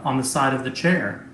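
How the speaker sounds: normal talking voice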